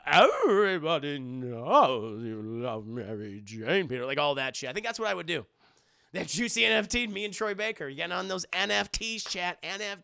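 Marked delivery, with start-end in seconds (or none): 0.0-4.0 slow low aunt voice; Aunt May impression. Listing merch quotes in Aunt May voice. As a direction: drag it out like old Aunt May. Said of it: "Aunt May".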